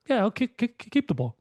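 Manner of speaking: high, sarcastic mock player voice; high mock-dumb voice. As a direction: parrot the advice to ridicule it. Mocking the dumb coaching advice.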